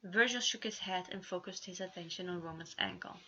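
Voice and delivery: plain reading voice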